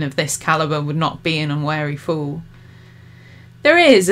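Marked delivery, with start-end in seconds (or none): 3.6-4.1 wicked echo, falling and firm; a wicked mocking echo. A narrator's reading. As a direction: throw his words back at him. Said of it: "Olivia repeated, his own words back to him".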